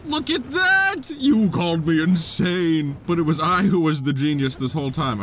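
villain gloat. Acted.

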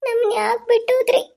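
high, slow sobbing for her lost parents; a little girl weeping for mum and dad; crying child. Acted. Orphan cries for dead parents.

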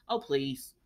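low, soft "Oh, please" with a scoff; a low, dismissive "Oh, please". Real, not acted. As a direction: wave it off under your breath.

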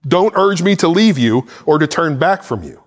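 ordinary talk

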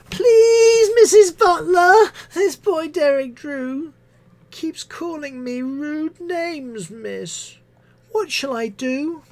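high, slow whimpering whine; tearful pupil. Acted. Pupil complaining about rude names, tearful whine. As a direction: whimper it like a child near tears.

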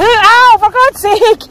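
sudden high loud ow of pain; pained outburst. Real, not acted. Knocked by a dog, real loud pained cry. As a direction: yelp as a dog lands on your back. Dog jumps on her back.

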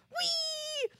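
put-on a kid let loose onto the playground; gleeful whee. Grasshoppers rush to playground.

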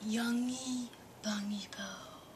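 whispered refrain, no voice at all. Real, not acted. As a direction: breathe the name out in a hushed whisper.

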